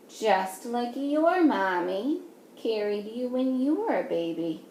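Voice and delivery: ordinary talk, nothing marked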